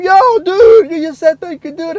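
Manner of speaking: high loud excited; amazed, voice jumping up; excited disbelief. Retold or imitated. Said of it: "I'm like".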